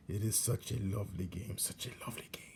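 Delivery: hushed whisper, savouring each word; gloating whisper. Acted. Old rat relishes the torture game in a gloating whisper. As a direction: whisper it like a treasured secret.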